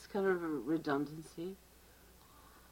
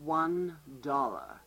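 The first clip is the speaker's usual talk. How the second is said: very slow, low deadpan "One dollar"; slow deadpan clerk; 3 semitones lower, much slower than usual. Acted. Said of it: "I'm the clerk".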